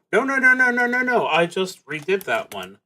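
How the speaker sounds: ordinary talk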